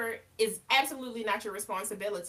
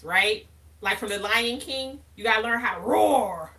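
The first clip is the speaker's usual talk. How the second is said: ordinary talk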